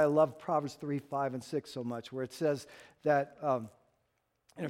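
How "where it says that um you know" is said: normal talking voice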